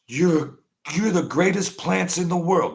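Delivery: high, sweet praise voice; praising the plants. Pretend. Man in an experiment praising the plants, high and sweet. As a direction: coo it up high like praising a baby.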